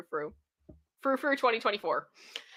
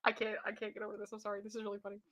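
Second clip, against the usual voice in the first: unvoiced breathy giggle-whisper; whispered laughing; 16 dB quieter, faster than usual. Real, not acted. Apologising to the listener while laughing, whispered. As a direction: barely get words out through laughter.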